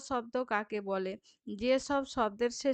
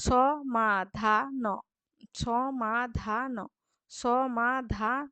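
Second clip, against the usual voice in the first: সমাধান very slowly, as স, মা, ধা, ন; split into syllables; much slower than usual. Shown as a demonstration. Teacher repeating for pupils, slow and syllable by syllable. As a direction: stretch সমাধান into four separate syllables. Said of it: "শেষ দলগুলির মধ্যে".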